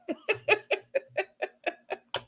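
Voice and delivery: high-pitched burst of laughter. Real, not acted. Laughing at own joke to viewers, high laugh. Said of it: "I'm laughing".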